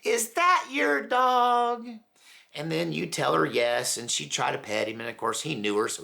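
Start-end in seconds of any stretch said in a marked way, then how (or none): 0.0-1.9 put-on very high, slow, sweet elderly lady voice; old lady voice. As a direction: coo like a delighted old woman. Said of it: "lady would go".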